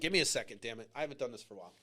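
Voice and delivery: overcome, sinking low and soft; real emotion, voice dropping away to very quiet; emotional. Real, not acted. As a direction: ask for a moment while choked up. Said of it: "I get emotional when I think".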